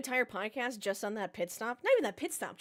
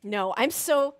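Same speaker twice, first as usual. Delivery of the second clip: frustrated outburst; 3 semitones higher, 9 dB louder, slower than usual. Real, not acted. Race result goes wrong.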